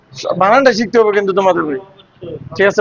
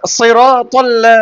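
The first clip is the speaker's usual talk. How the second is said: সিরাতাল্লা drawn out slowly, loud and clear; slow model recitation; 3 dB louder, much slower than usual. Shown as a demonstration. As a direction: spell out সিরাতাল্লা slowly for a child to copy. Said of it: "teacher models for student". (ধীরে ধীরে টেনে শেখানো)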